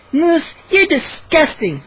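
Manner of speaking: slow, high groan of disgust. A narrator's reading. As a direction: groan at a gross friend, dragging the words. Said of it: "Mindy groaned".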